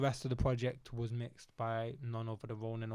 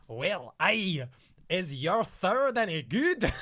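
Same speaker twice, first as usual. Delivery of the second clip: hammed-up Scottish accent, higher and louder, laughing through it; mock Scottish accent; 4 semitones higher, 8 dB louder, slower than usual. Teasing his battle opponent backstage, in a laughing mock Scottish accent. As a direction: tease him in a jokey put-on Scottish accent. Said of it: "I was meant to do a Scottish accent".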